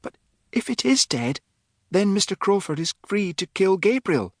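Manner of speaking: put-on high, innocent girlish voice; Philippa, ingenuous. A narrator's reading. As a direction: state the dark point with artless innocence. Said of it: "remarked Philippa".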